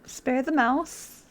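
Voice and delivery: stretched, stubborn repeat; drawn-out defiant choice; slow repeat. Real, not acted. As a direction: say it slowly like a refusal. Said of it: "repeating choice defiantly".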